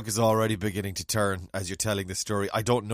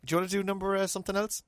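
ordinary talk

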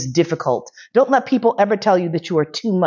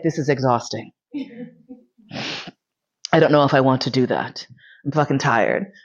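tired; 5 semitones lower than usual. Retold or imitated. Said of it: "I was like".

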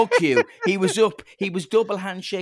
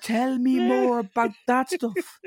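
higher, slow, mock eager plea; eager listener; 8 semitones higher, slower than usual. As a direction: beg for more, playfully. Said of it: "You're just like".

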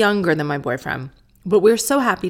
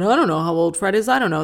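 pretend clueless parent; faster than usual. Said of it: "if I went on and I said".

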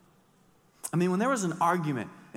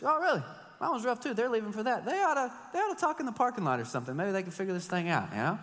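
retold reply; 7 semitones higher, faster than usual. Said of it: "I was like".

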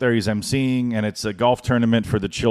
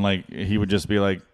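ordinary talk

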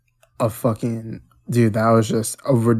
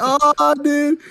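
much higher excited cry; excited high squeal. Retold or imitated. Fan reacting to the fight, high excited squeal. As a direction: squeal with delight. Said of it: "I was like".

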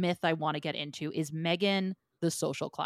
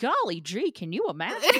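higher-pitched golly-gee amazement; mock naive wonder; 5 semitones higher, 5 dB louder than usual. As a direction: put on wide-eyed, exaggerated wonder. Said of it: "I'm over here like".

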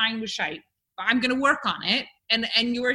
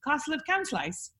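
softer, higher copy of Stoke dialect; Stoke attempt; 5 semitones higher, 7 dB quieter than usual. Shown as a demonstration. Host copies the phrase, tentative Stoke accent. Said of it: "How about this?".

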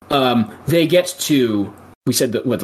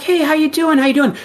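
1 mock friendly check-in; 10 semitones higher than usual. Eartha voiced checking on Doug.

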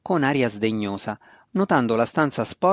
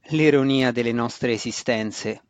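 usual speaking voice

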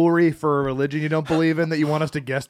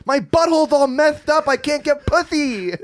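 higher, camp complaining voice; 9 semitones higher than usual. Pretend. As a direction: whine it high and camp.

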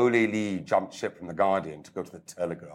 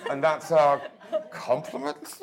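amused disbelief; 7 semitones higher than usual. Real, not acted. Reacting to backhanded praise.

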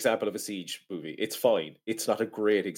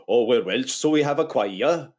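put-on Welsh accent sliding into Indian; slow, singsong bad Welsh impression; botched Welsh accent; 4 dB louder, slower than usual. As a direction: attempt lilting Welsh, landing closer to Indian. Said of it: "That was my Indian".

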